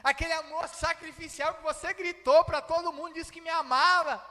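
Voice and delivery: loud, high preaching challenge; emphatic confrontation. Acted. Jesus challenging Peter about his boasted sacrificial love, emphatic confrontation. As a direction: press the question forcefully, high and loud.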